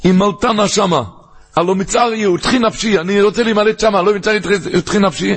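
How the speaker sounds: high-pitched urgent pleading; pleading cry. Acted. Lot begging the angel to let him escape, high pleading cry. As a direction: plead for your life, pitched well up. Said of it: "הוא צעק".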